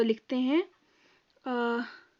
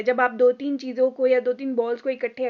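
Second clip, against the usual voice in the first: ordinary talk, nothing marked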